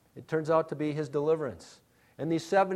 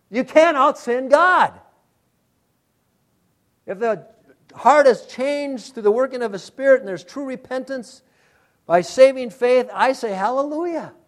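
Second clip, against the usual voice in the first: higher, louder fervent preaching; preaching at full force; 8 semitones higher, 6 dB louder than usual. Real, not acted. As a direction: proclaim it to the congregation.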